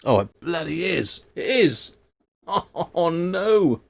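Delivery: ordinary talk, nothing marked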